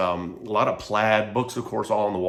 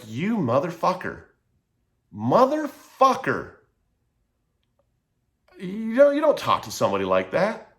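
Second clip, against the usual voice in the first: angry outburst; 8 semitones higher than usual. Retold or imitated. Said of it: "out of pure frustration and pure anger".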